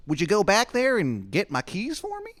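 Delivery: very high, loud, quick falsetto; Shithead Steve asking. Acted. Steve asking Adam for a favor, fast high falsetto. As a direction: rush the request up high. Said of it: "He goes".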